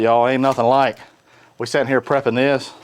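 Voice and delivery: ordinary talk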